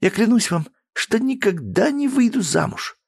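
high scornful vow; vowing with hatred. Acted. Daughter swearing to her father, scornful. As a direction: swear it with contempt. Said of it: "пропитано ненавистью и презрением".

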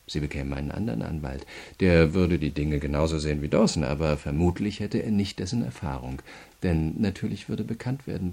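ordinary delivery

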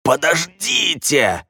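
high, drawn-out strangled plea; desperate pleading. A narrator's reading. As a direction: beg them to wait, voice straining upward. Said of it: "взмолился Редж".

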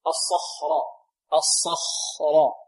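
الصخرة with heavy ص and خ, stretched slowly; heavy sad and kha. Shown as a demonstration. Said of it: "هكذا". (الصخرة بتفخيم وببطء)

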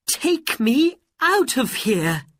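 loud, high urgent demand; frightened plea. Acted. Frightened Aladdin asking genie for rescue, urgent plea.

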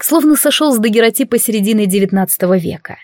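ordinary delivery

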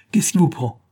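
a master berating his servant in anger; angry rebuke. A narrator's reading. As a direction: tear into the insolent servant. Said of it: "apostrophant violemment le domestique".

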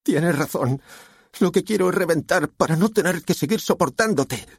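high-pitched furious daughter; vociferating. A narrator's reading. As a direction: lash out in a high voice. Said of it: "acabó por vociferar".

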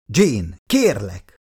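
slow, begging plea; pleading. A narrator's reading. Father begs his daughter to stop, slow pleading. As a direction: stretch the words out, imploring. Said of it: "fogta könyörgőre a hangját".

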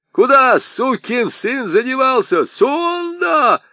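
very high, loud yell for him across the snow; shouting a name. Acted. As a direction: bellow his name into the distance. Аргылов зовёт пропавшего слугу. (громкий высокий крик вдаль)

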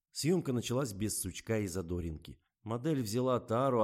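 ordinary delivery

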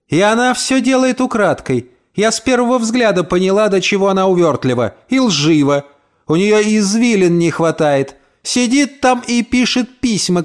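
high-pitched spiteful gossip; mock the neighbour with venom; spiteful rant. Landlady rants about neighbour. (язвительная тирада, голос выше)

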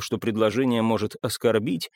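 ordinary delivery

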